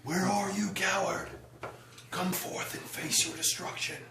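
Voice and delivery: high-pitched challenge to a coward; battle shout. Acted. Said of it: "Then I'm gonna shout".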